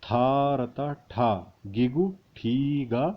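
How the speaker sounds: slow and drawn out; slow spelling out. Shown as a demonstration. Teacher spelling letters slowly for students. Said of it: "उसके बाद है".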